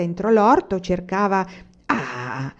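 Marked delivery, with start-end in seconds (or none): none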